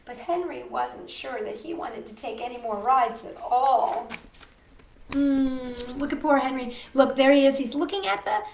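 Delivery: usual speaking voice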